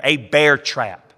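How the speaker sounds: usual speaking voice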